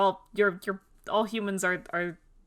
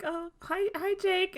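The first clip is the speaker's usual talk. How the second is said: timid greeting; 10 semitones higher, 4 dB quieter, slower than usual. Retold or imitated. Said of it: "he's just like".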